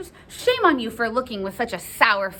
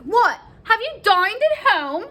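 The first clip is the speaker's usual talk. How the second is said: high, loud, rising query; nurse's theatrical surprise; astonished question; 12 semitones higher, 5 dB louder than usual. Acted. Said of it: "So she's like".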